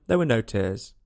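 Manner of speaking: ordinary delivery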